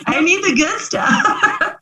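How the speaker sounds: high, slow, laughing through the words. Real, not acted. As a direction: giggle it out, mock-greedy. Joking about cookies, laughing.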